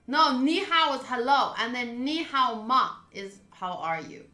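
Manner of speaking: ordinary talk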